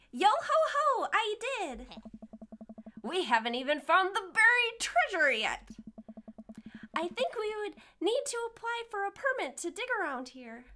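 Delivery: put-on very high child voice; little kid. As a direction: pipe up like an eager little girl. Acting the child Daisy.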